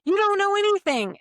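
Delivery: high, loud accusing yell; mock screamed insult. Attacker yelling insults at you, high mock yell. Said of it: "But if they're saying".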